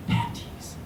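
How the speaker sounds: soft, voiceless taunting whisper. Acted. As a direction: whisper it like a secret jab at a sibling. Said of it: "my sister whispers".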